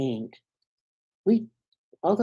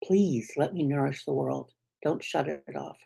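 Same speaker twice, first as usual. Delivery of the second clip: tender plea; 3 semitones lower, 5 dB quieter than usual. Retold or imitated. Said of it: "all of you is saying".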